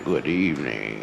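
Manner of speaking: low, slow creepy host. Retold or imitated. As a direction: greet them like a horror host. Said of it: "And then he would come in".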